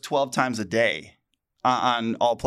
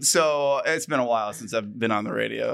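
ordinary delivery

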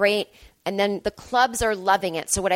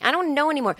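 higher, full-voiced restart; exasperated uncertainty; 5 semitones higher, faster than usual. Real, not acted. Podcaster at a loss, higher exasperated uncertainty. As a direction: lift the pitch in helpless confusion.

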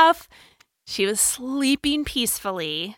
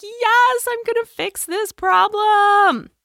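high, loud, gloating triumph; triumphant; 12 semitones higher, 4 dB louder, slower than usual. Retold or imitated. Mom reliving her bedtime win, triumphant. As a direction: crow over the fixed problem.

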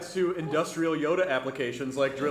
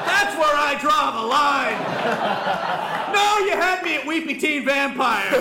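loud, laughing, higher mock protest; laughing mock outrage; 8 semitones higher, 6 dB louder than usual. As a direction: shout it through laughter.

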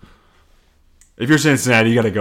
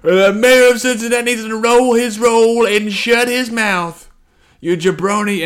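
trash-talk like a pro wrestler, much higher; imitating Kelce; 11 semitones higher, 5 dB louder than usual. Kelce firing back at the mayor, hammed-up wrestler taunt. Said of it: "cut to Travis Kelce being like".

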